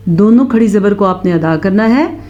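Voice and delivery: ordinary talk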